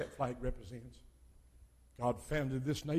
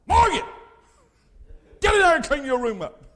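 loud mock mom shout, higher; mom yelling; 12 semitones higher, 13 dB louder than usual. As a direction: bark it like an upset mom calling her kid. Mom yelling at child, retold.

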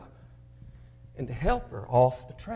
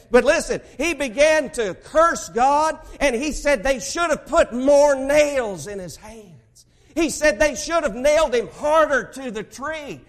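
loud, higher preacher's build, slow at first; preaching; 8 semitones higher, 8 dB louder than usual. Acted. Preacher telling congregation of the man cursing God, fiery. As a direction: drive the story home from the pulpit.

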